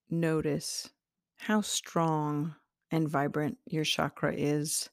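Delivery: unhurried meditation voice with long pauses; guided meditation. Acted. Guiding listeners to their sacral chakra, calm meditation voice. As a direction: ease the listener inward, gently paced.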